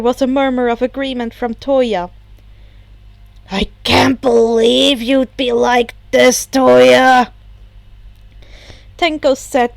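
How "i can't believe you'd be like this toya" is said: a touch of hurt betrayal; betrayed. Acted. Tenko hurt that Toya never learned the game. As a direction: sound let down by a friend. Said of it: "betrayal clear in his voice".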